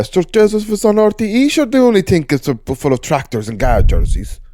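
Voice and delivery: put-on high, loud Irish country accent. Podcast guest playing a rural local for the host, country accent. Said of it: "I'll keep it country".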